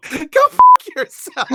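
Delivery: very high, loud outburst; mock outraged shout. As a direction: explode at your co-host in play. Mock outburst after being teased.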